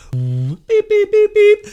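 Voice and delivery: high electronic beeps; imitated beeping. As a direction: beep like a breathalyzer.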